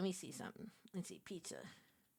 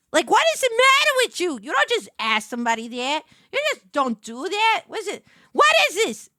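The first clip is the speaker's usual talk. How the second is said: much higher and louder, mock-outraged New York rant; indignant rant; 7 semitones higher, 14 dB louder than usual. Real, not acted. Streamer telling chat her accent is real, mock outrage. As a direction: go big and offended, defending your own voice.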